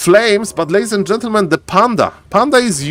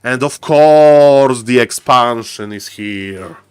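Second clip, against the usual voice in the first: drawn-out, sinking resigned commentary; slow, low mournful drawl; slow lament; 4 semitones lower, slower than usual. Real, not acted. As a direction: stretch the words slowly, dropping low. Caster laments the cheap tactic.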